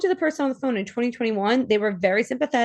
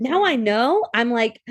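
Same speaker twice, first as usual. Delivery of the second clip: ordinary talk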